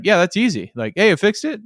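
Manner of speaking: lightly acted, upbeat and casual; breezy customer patter. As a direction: toss it off like an easy win. Acts out an easy, successful job.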